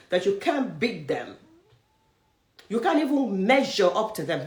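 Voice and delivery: normal talking voice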